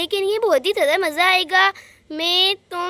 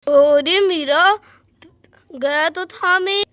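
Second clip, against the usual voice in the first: slow, drawn-out reluctant admission; hesitant admission; 5 dB louder, much slower than usual. Acted. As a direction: stall before owning up. Veer caught out, hesitant. (धीमे-धीमे झिझकते हुए मानना)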